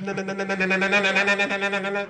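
long run of rapid, even na-na-nas; mouth-made bionic sound effect, held going; imitated bionic sound effect. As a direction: mimic the bionic-action noise on one steady note. Mimics TV show's bionic sound effect.

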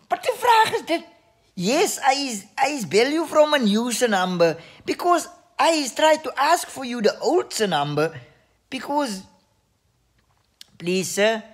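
squeaky mocking caller voice. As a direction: ham up a clueless caller, high and loud. Mock voice of caller, broken English.

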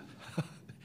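brief breathy chuckle; a small laugh mid-sentence; short laugh. Real, not acted. Coach remembering the fun with his players, short laugh.

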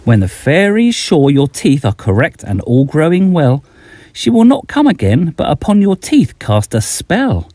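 usual speaking voice

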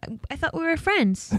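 high, soft, wounded friend act; softly hammed-up hurt feelings; mock hurt. Acts a dropped school friend.

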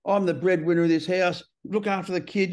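lightly hammed-up macho husband; chauvinistic male. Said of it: "just chauvinistic male".

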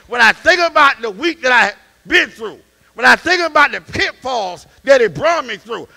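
loud preaching climbing to shouts; preaching at full force. Acted. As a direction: whoop it up to the congregation.

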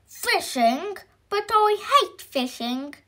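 high-pitched, grumpy objection; indignant protest. Acted. Mister Grumble rejects Mister Happy's fishing idea, indignant.

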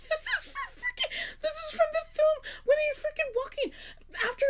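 very high, squealing excitement; sky-high giddy fan babble; thrilled recognition. Real, not acted. Recognising a film reference.

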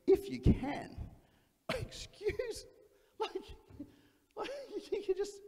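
hammed-up indignant surprise; mock offended. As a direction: throw the words back in disbelief. Jesus takes offense.

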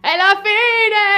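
hammed-up doom cry; despairing wail. Despair at jealousy chaos.

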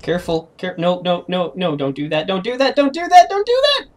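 warning climbing into a high, loud squeal; escalating panic. Real, not acted. Player warning a teammate off danger, panic building to a squeal. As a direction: start controlled, build to frantic shrieking.

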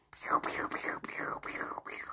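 whispered pew pew sound effects. Shown as a demonstration. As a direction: breathe out soft pews with each dab. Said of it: "demonstrating dabbing strokes".

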